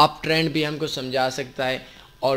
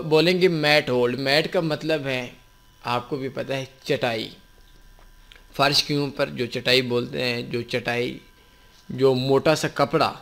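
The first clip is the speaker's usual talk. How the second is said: ordinary delivery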